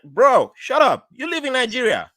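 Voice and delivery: loud, high, amused telling-off; high, emphatic, playful rebuke; mock scolding. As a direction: scold a younger relative, close to laughing. Acting his scolding of nephew.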